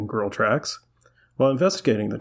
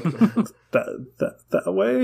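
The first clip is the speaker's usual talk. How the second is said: higher, halting mock bewilderment; confused stammer; 8 semitones higher, slower than usual. Host imitates Bones pointing after the rabbit, confused stammer. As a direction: stumble on the word, rising to a baffled question. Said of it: "so confused he can't form words".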